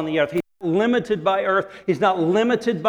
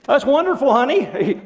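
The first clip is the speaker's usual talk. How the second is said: higher, louder overdone praise; doting parent; 8 semitones higher, 4 dB louder than usual. Retold or imitated. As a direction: gush like a parent humoring a child. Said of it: "And you're going".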